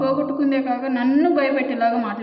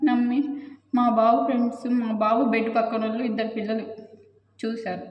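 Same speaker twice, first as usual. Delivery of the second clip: usual speaking voice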